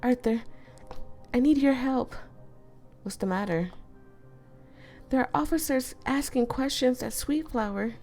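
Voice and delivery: put-on high girl's voice, pleading; lightly voiced pleading girl; Emiko voice. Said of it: "Emiko voiced in story".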